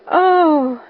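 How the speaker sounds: put-on lifted child's exclamation; amazed. A narrator's reading. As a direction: let out a wondering sigh of a word. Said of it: "Anne drew in a long breath".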